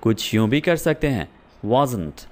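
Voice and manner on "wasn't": "wasn't" as one quick word; contraction. Shown as a demonstration. Teacher introducing the contraction to students, smoothly. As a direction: fold "was not" into "wasn't". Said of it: "fluently बोलने के लिए".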